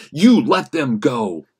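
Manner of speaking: loud, slow command; roaring. Acted. Frank ordering the men to release them, slow roar. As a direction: hammer each word out. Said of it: "Frank roared with all of his power".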